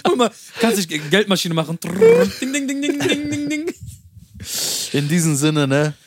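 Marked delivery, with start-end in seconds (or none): 1.8-3.7 high bright ding-ding chime voice; cash machine dings. Acted. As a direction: ring it out like a slot machine. Imitates a money machine.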